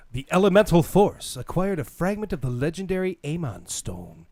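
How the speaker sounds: lightly dramatic trailer read; promo read. Acted. Reading the toy teaser to viewers, light trailer read.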